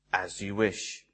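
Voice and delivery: Westley answering Buttercup, low, soft and devoted; devoted reply. Retold or imitated. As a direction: murmur it like a quiet vow of love.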